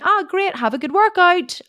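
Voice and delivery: cheery husband. Retold or imitated. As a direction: imitate his breezy, chirpy send-off. Husband's cheery reply, retold.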